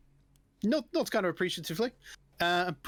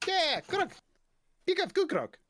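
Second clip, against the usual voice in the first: very high kobold voice; pleased little creature, pitched way up; high broken-English kobold; kobold barkeep; 12 semitones higher than usual. Acted. Kobold agrees to serve grog.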